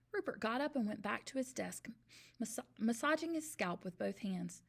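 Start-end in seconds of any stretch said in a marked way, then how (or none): none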